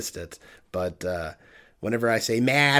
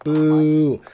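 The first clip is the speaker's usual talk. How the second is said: mock boo; 5 semitones higher, 8 dB louder than usual. Jokey shout after TikTok.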